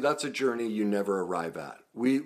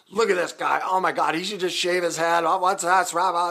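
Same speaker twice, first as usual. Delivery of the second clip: mocking commenters; 9 semitones higher, 6 dB louder than usual. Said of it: "people just started going off about my hair".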